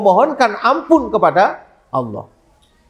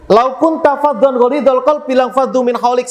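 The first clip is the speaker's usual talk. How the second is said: Quran recitation; 5 semitones higher than usual. Acted.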